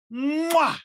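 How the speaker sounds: high smacking mwah; loud kiss sound. A narrator's reading. Little Shock kissing the monster's cheek, loud mwah. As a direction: plant a big smacking kiss.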